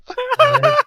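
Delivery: high, loud burst of laughter; real helpless cackle; laughing. Real, not acted. Cracks up at accent question.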